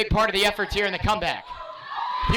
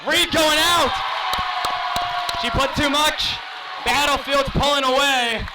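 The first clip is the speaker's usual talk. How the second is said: excited play-by-play; 4 semitones higher than usual. Real, not acted. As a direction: call the rally with energy. Live volleyball play-by-play.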